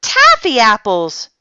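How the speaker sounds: delighted shout. A narrator's reading. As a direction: cheer at the treat. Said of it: "everybody shouted".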